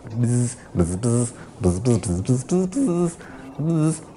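buzzing notes at different pitches; fly buzzing. Acted. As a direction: buzz like tuned flies. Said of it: "a fly keyboard".

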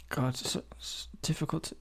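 soft whisper of frustration. Real, not acted. Vlogger whispering to herself while drawing a letter. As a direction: breathe it under your breath.

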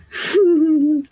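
a high burst of real giggling; giggle. Real, not acted. Host giggling mid shout-out, high real giggle. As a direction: let a giggle slip out, amused. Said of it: "That's why I'm giggling".

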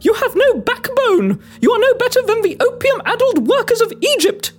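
high-pitched scolding; indignant cry. Acted. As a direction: berate the crew in lofty outrage. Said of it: "I cried".

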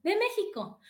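high, proud "De México"; proud reply to the Paris cashier; proud answer. Retold or imitated. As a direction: announce "México" with pride, pitched well up.

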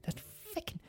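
barely audible angry whisper; hissed whisper, extremely soft; very hushed. Retold or imitated. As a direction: imitate a mother cursing under her breath. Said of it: "in a very hushed voice".

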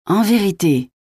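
high, delighted exclamation; light up with delight, pitch jumping up; beaming. A narrator's reading. (rayonnant, voix haute et ravie)

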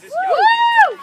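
very high whoop; excited whoop. Real, not acted. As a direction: whoop to rally the crowd. Rallying the crowd.

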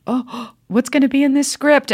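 high, gasping excitement; acted thrill with a sharp intake of breath; eager anticipation. Actor about to open a new script, gasping with anticipation. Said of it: "it's just like".

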